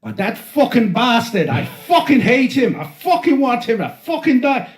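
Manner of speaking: high, loud Irish cursing rant; Kathy's angry switch. Retold or imitated. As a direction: switch into a furious mock Irish tirade. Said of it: "She would switch on somebody".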